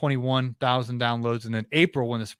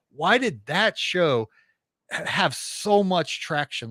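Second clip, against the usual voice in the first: slow, higher, drawn-out query; puzzled question; 4 semitones higher, much slower than usual. Retold or imitated. Guest retelling how he asked Mister Producer, slow puzzlement. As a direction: stretch it out in bafflement.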